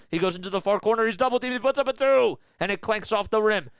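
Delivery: rising excited play call. Acted. Big play, double team, miss.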